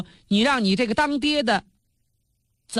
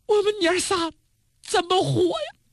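pitched-up wail of a weeping concubine, lightly acted; tearful wail; 10 semitones higher than usual. Qi Jiang wailing to the drunk duke, pitched-up acted crying. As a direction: cry out the last words high. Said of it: "他这一哭，痛哭失声".

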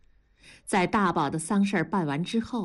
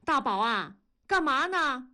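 calling higher down the phone; pitched-up call into the phone; raised voice call; 6 semitones higher than usual. A narrator's reading. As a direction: raise your voice to reach him on the line. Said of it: "同志提高了嗓门喊".

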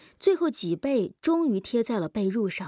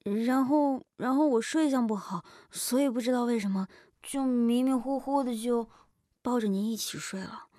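stammering explanation; 3 semitones higher than usual. A narrator's reading. Jin Duoduo explains the accidental hug, flustered and stammering. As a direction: repeat yourself, fumbling for an excuse. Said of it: "金多多已经结结巴巴地说道".

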